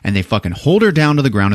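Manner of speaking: ordinary delivery, nothing marked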